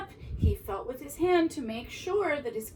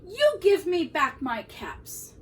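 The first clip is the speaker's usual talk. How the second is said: higher, louder storybook telling-off; scolding demand; 5 semitones higher, 6 dB louder than usual. A narrator's reading. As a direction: demand it back like an indignant peddler. Peddler demands his caps back.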